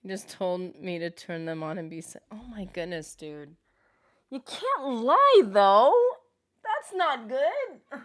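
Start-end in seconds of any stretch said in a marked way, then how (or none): 4.3-8.0 high, slow, stretched-out complaint; drawn-out dismay. Real, not acted. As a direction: stretch every word in disbelief. Drawn-out reaction.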